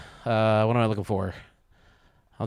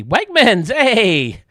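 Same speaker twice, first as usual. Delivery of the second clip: excited hey; 10 semitones higher, 9 dB louder, much slower than usual. Real, not acted. Excited greeting of stadium.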